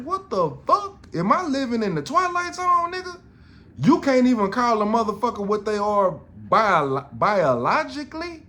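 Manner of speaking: can't believe what he's hearing, half shouting; incredulous outcry. Retold or imitated. Man stunned that a woman objects to being called female, high incredulous outcry. Retelling disbelief at woman's anger.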